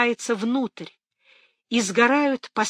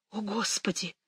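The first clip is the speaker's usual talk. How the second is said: hushed dismay; 11 dB quieter than usual. A narrator's reading. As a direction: let it drop away in dread. Sees the fire cloud, hushed dismay.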